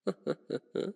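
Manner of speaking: soft, short vampire chuckle; villainous chuckle. Acted. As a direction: chuckle to yourself at your prey. Vampire amused by captive hunter.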